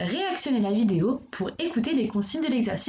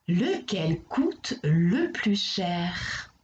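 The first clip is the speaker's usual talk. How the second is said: "Lequel coûte le plus cher" drawn out, very slow; very slow question; 4 semitones lower, much slower than usual. Shown as a demonstration. Teacher reading the question to a pupil, very slow. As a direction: read the question word by word.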